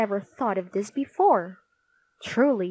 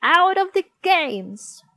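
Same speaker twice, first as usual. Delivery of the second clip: high, loud, drawn-out dismissal; furious schoolteacher's yell; Miss Battle Axe's shout; 8 semitones higher, 7 dB louder than usual. A narrator's reading. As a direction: bellow it at a pupil. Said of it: "shouted Miss Battle Axe".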